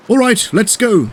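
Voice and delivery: high-pitched, urgent readiness; rally to leave at once. A narrator's reading. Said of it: "he said urgently".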